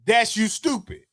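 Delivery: ordinary delivery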